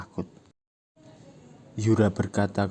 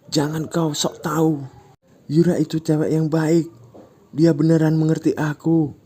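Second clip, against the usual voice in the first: high, louder angry retort; angry defense; 9 semitones higher, 6 dB louder than usual. Acted. Ujang angrily defending Yura to Ucok, heated. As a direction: snap back defending her. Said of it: "Ujang sambil marah berkata".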